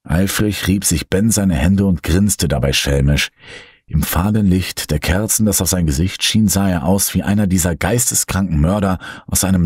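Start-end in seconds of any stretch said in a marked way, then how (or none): none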